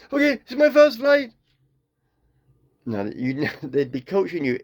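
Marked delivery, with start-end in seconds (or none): 0.1-1.3 nervous rookie pilot. Acted. Nervous new pilot on intercom.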